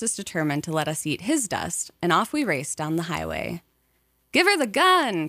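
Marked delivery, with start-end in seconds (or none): 4.3-5.3 higher, louder urging shout; eager cry. A narrator's reading. Passenger urging father to speed up, loud eager cry. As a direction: egg the driver on, loud. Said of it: "Tracy cried".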